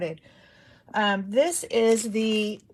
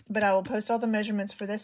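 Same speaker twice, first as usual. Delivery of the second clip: ordinary talk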